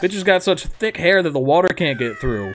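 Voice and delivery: ordinary delivery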